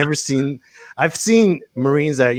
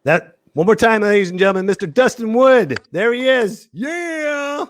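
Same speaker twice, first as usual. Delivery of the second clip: high, loud hype-man introduction; show announcer; 12 semitones higher, 3 dB louder than usual. Acted. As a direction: whip up applause, stretching the last cheer high. MC whipping up applause for comedian.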